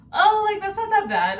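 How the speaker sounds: high, loud mock of a guy shrugging off a price; mock of the young caller acting unbothered, high and loud; mock casual brag. Mocking the guy flexing on price.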